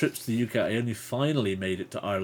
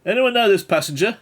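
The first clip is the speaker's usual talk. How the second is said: loud, high call across a boat; calling passenger; 11 semitones higher, 10 dB louder than usual. Acted. Passenger asks the others about a stranger, loud high call. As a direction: call out to everyone aboard.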